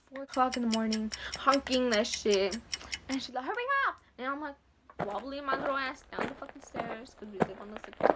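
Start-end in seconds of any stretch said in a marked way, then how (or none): 3.4-4.0 high, loud call from outside; impatient yell. Retold or imitated. Sister honking outside at four a.m., impatient yell. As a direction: imitate someone yelling to hurry them along.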